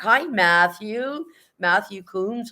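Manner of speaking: high, drawn-out hello; slow, lifted singsong greeting; excited greeting. Real, not acted. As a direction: stretch out a delighted welcome to someone back. Greets a returning viewer.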